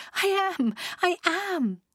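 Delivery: very high, emphatic joy; thrilled. A narrator's reading. Mimi tells Ed she is thrilled, high and overjoyed. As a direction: insist it happily, pitch leaping up.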